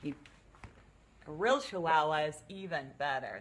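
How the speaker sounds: ordinary talk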